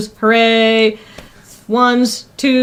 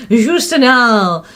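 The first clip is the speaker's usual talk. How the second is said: slow sultry fragrance commercial voice; drawn out, seductive ad read; sultry perfume ad; slower than usual. Pretend.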